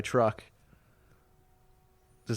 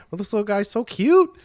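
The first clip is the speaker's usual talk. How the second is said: cooing over pictures; 11 semitones higher, 4 dB louder than usual. Real, not acted. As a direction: coo at the cute kitten photos. Showing cute kitten pictures.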